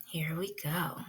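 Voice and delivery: hushed whisper; teacher whispering to quiet the class. Real, not acted. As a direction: breathe it to start the story. Teacher hushes before story.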